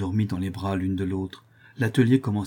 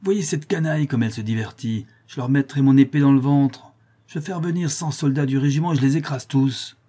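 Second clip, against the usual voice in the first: loud, higher, scornful threat; shouting officer; 5 semitones higher, 5 dB louder than usual. A narrator's reading. Officer shouts threats at the patriots, furious. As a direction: bellow at the crowd. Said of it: "se mit à crier".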